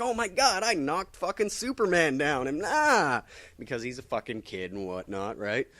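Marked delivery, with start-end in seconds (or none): 0.0-3.2 much higher, giddy exclamation; mock childish delight; kid's glee. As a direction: gush like an amazed kid. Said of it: "He's like".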